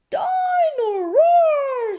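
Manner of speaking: put-on very high, loud and slow toddler squeal; little George voice. Little brother naming his new toy, high slow squeal. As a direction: stretch the name like a thrilled little piglet.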